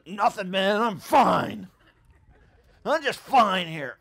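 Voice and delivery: loud, strained "I'm fine", slowing at the end; forced cheerful denial under a heavy load; fake fine. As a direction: insist you're fine while clearly not. Acting someone denying pain.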